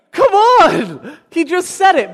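high, loud exasperation; incredulous outburst. Real, not acted. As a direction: burst out in amused disbelief. Speaker exclaims at student's belief.

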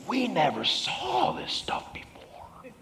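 hushed, drawn-out amazement; amazed. Acted. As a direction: whisper it very slowly in awe. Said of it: "they were all amazed and glorified God".

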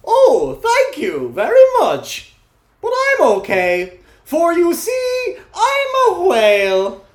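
high, loud, slow whale voice. Acted. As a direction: stretch it out like a happy whale. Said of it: "do the whale voice".

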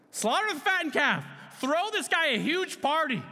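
high, loud, jubilant orders; excited father. Acted. Father ordering a feast for his returned son, excited shout. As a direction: call out the party orders at full pitch. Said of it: "The father's just like".

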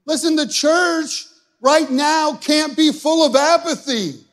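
loud, high, slow fiery preaching; full-force preaching. Acted. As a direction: drive it home to the congregation, slow and loud.

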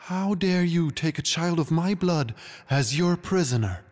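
high-pitched regal outrage; outraged queen accusing a rival; furious queen. A narrator's reading. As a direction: demand it indignantly. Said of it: "with furious eyes".

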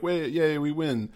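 a small put-on hooray; mock cheer. Retelling the rescued crew celebrating, light mock cheer.

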